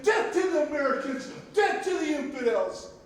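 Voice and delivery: loud, high proclaimed slogan; shouted slogan. Retold or imitated. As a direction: belt out the enemy's slogan. Reading out enemy graffiti to audience.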